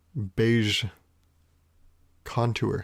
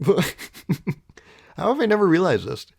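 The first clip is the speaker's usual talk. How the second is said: laughing; 5 semitones higher, slower than usual. Real, not acted. Laughing disbelief at apples.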